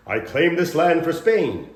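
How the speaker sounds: loud, high, slow proclamation; improv explorer's proclamation. Acted. Improviser making an opening offer, loud proclamation. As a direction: proclaim it like a stage conqueror.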